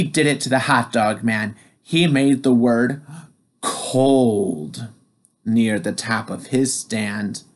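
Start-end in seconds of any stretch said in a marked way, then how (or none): none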